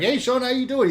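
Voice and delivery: loud, high-pitched, energetic greeting; imitated high energy. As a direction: imitate a customer bursting in full of energy. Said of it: "He's, like, all full of energy".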